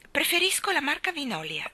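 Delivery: high, haughty and superior. A narrator's reading. Mary Poppins haughtily to Father Christmas, high and haughty. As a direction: looks down her nose at Father Christmas. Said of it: "disse altezzosa".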